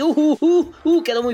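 loud high uh uh uh; excited hooting. Real, not acted. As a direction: hoot with excitement. Emoción al ver la clasificación. (emoción a gritos)

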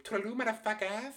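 high, sing-song mocking farewell; Ken Jeong impression. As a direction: chirp a cheeky goodbye up high. Ken Jeong character quoted.

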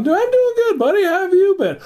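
high-pitched retold hello; hearty greeting. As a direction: act out greeting an old buddy.

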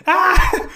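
sharp high exasperated cry "Ah!"; a loud, high burst of frustration; frustrated yell. Real, not acted. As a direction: let out a loud, fed-up yelp. Frustrated by conflicting teachings.

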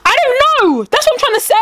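high-pitched, frustrated protest; exasperated. Real, not acted. Protesting to a friend about a hated question, exasperated. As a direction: burst out, pitch jumping way up.

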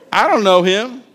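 dismissive mock denial; lightly acted defensive denial; mild denial. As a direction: brush off the accusation, acted. Acts Peter's first denial.

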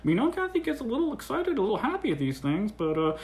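soft mock gossip among coworkers; hushed murmuring. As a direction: imitate onlookers quietly conferring. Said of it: "hushed down a little bit".

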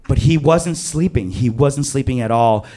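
usual speaking voice

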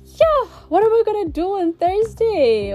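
tearful whine. Real, not acted. Podcaster dreading no Thursday episode, tearful whine. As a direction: let the sadness spill out playfully. Tearful lament at season end.

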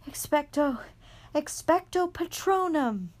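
very high loud cry; desperate scream. A narrator's reading. Harry alone against the Dementors, screaming. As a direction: throw everything into the spell.

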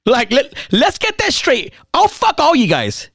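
cocky joking declaration. Retold or imitated. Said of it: "and I go".

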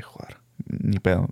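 slow, whispered resigned curse; slow hushed grumble. Retold or imitated. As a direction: whisper it to yourself, giving up. Muttering his reaction in class.

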